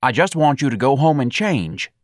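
high, agitated pleading; exasperated urging. A narrator's reading. Office manager sends employee home to change, exasperated.

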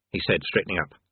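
plain reading voice

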